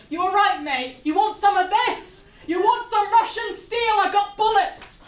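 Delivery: loud, high mock tough-guy taunt; Russian pilot taunt. Hammed-up Russian pilot taunting the American pilot, shouting. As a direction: jeer at him like a swaggering pilot, loud and high. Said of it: "and just goes".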